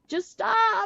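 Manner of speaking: desperate plea. Retold or imitated. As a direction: crack upward on "stop" in desperation. Wishing the crying would end.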